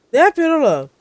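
loud, high yell at a game character; startled yell. Real, not acted. Player yelling at his companion, loud and high. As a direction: yell in disbelief at the screen.

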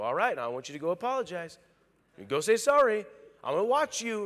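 fast, loud parent command; a hint of a stern parent. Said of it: "I will tell people".

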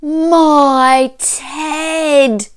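high, loud gasp of shock; gasp, then cry it out. A narrator's reading. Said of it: "Gasped the bear".

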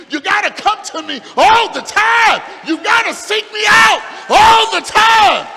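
preaching shout. Acted. Preacher shouting God's command to the church. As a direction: thunder it out as God's demand.

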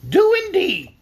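high, loud hen retort; punchy talking-hen comeback; contradicting hen. A narrator's reading.

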